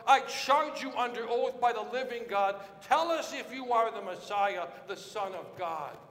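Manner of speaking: a high priest's furious public demand; angry shout. Acted. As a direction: bellow it at the silent prisoner. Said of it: "he shouts out".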